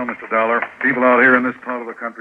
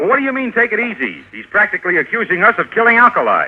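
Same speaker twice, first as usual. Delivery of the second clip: higher, heated protest; outraged; 9 semitones higher, 4 dB louder than usual. Acted. Danny protesting Dollar's accusation, outraged and higher. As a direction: bristle at the insult.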